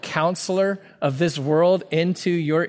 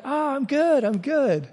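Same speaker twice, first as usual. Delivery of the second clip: higher, overly sweet phone answer; fake cheerful; 8 semitones higher than usual. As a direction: cover the anger with a chipper reply. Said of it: "I'm like".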